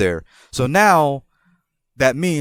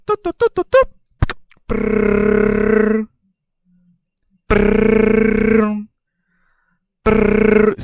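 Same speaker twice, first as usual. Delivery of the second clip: high beeping doops then rolled brrr rings; imitated phone dialing and ringing; 9 semitones higher, 4 dB louder, much slower than usual. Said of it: "that's the sound of the phone ringing".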